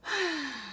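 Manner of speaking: long slow relaxed exhale; yawn breath. Shown as a demonstration. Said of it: "Yawn breath".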